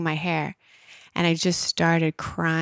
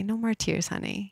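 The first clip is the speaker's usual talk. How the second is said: tender hush; 3 semitones higher, 7 dB quieter than usual. Retold or imitated. Her heart comforting her, a soft tender hush. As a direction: soothe her like a loving mother would. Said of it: "hearing my heart say".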